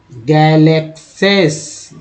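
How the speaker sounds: गैलेक्सेस stretched out slowly, syllable by syllable; teacher drawing out गैलेक्सेस slowly; slow spelled-out reading. Shown as a demonstration. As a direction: read गैलेक्सेस very slowly for the class to follow. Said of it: "ध्यानपूर्वक देखेंगे समझ में आएगा कि कैसे पढ़ा गया है".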